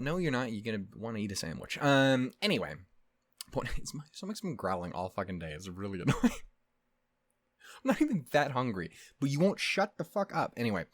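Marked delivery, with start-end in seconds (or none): none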